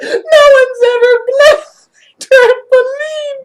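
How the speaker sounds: high, loud, slowing mock wail; retold wail. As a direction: wail it while helpless with laughter. Acting out friend through laughter.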